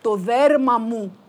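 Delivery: slow, loud, rising cry of recognition; joyful recognition. Acted. Wife recognising her lost skin, slow and loud. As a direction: savour finding what was lost.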